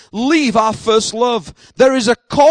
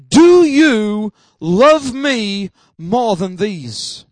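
drawn out very slowly, word by word; weighty, stretched-out voicing of Jesus; solemn retold question; 4 dB louder, much slower than usual. Jesus questioning Peter after his denial, solemn and slow.

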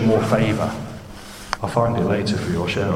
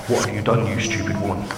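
ordinary talk, nothing marked

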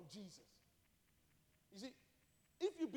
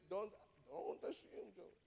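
badly faked Irish accent, slow and softer; attempted Irish accent; 7 dB quieter, slower than usual. Pastor imitates his old Irish pastor, poor Irish accent. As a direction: have a halting go at Irish. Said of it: "I can't do the Irish accent".

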